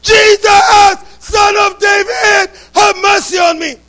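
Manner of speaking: a blind beggar shouting for mercy over the crowd. Acted. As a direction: cry out loud for Jesus to hear you. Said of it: "he continued all the more".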